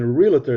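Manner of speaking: realtor with an extra vowel, REAL-uh-ter; mispronounced realtor. Shown as a demonstration. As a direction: mimic the wrong realtor, "ree-luh-ter". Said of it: "there's no I in".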